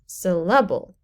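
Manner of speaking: "syllable" with the second syllable wrongly stressed, sil-LA-bul; stress on middle syllable. Shown as a demonstration. Teacher shows strange wrong stress, middle-syllable stress. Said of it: "if you have the stress on the wrong syllable".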